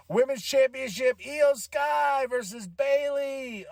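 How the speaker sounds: high, drawn-out match announcement; big ring-announcer build-up; mock ring announcer. Announcing the title match with relish.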